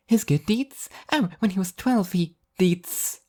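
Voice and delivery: high, sing-song airy angel; bubbly and blissfully oblivious; cheery angel voice. A narrator's reading. Spaced-out angel teasing the sleepy devil, high and chirpy.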